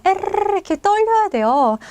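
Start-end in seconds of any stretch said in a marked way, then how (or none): 0.1-0.5 에르르 with the r trilled long; rolled r. Shown as a demonstration. Teacher giving a tip on vibration, 에르르 as long trill. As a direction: let the tongue flutter on through 에르르. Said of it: "떨려야 되잖아요".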